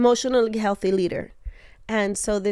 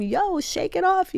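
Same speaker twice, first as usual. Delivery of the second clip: mock pep talk; 10 semitones higher than usual. Quoting what she can't tell him.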